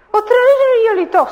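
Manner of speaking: high, loud hurrying mother; a storyteller's busy mum; mother scolding. A narrator's reading. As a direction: chase the boys out the door. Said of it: "zegt moeder".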